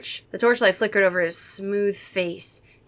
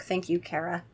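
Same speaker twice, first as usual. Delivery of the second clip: ordinary delivery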